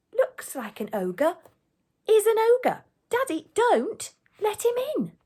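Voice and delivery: slow start, then high loud yell. A narrator's reading. Daughter warns her father about the ogre, yelling high. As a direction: build to a shrill child's warning. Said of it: "the gatekeeper's daughter yells".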